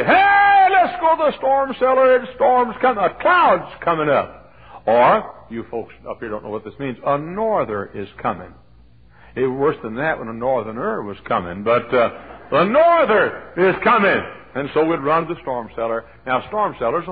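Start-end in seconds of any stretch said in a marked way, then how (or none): 0.0-4.5 very high, loud imitated holler, settling toward the end; mother's alarmed holler. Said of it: "And Mom would say". 12.5-14.2 high, loud, drawn-out call; mimicked storm warning.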